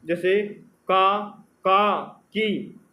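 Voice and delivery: का, का, की said slowly, one by one; slow syllable demo. Shown as a demonstration. Teacher showing consonants joined to vowels, slow and clear. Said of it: "स्वरों की सहायता से बोला जाता है".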